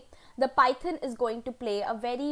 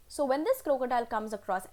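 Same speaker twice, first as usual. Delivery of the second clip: ordinary delivery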